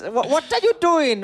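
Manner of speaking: high, hammed-up Korean accent; a joking Korean accent replay; a light Korean accent, playful; mock Korean accent. Shown as a demonstration. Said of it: "That was".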